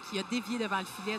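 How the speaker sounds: usual speaking voice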